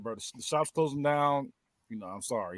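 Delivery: soft, low sorry boss; quiet apology. Retold or imitated. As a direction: break the bad news softly. Boss laying him off.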